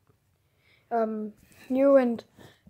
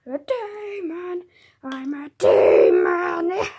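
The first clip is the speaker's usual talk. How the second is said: slow demon voice, ending louder; mock demon boast; 4 semitones higher, 6 dB louder, slower than usual. Villain proudly tells the hero he is a demon. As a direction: build the demon claim slowly to a shout.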